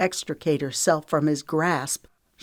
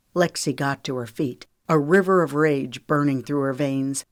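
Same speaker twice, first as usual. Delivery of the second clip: ordinary delivery, nothing marked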